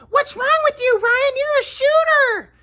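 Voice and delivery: high mocking scold, very high; mock angry mom. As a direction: parody mom's angry scolding. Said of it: "my mom was angry".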